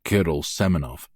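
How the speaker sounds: ordinary delivery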